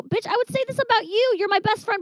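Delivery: high, cheeky comeback; high mock-sassy confession; sassy retort. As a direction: toss it back at the friend with attitude. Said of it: "I go".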